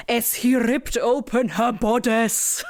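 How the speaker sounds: slow, breathy romance-novel read; dramatic reading. Retold or imitated. Reading a line from the book aloud, slow dramatic romance read. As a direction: savour each word like a bodice-ripper. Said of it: "a sentence that I know I've read".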